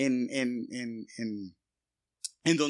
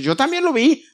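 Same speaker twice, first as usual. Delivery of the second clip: much higher, louder busybody voice; mocking gossip; 10 semitones higher, 6 dB louder than usual. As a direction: squeak it like eager gossips chiming in. Gossips who saw nothing.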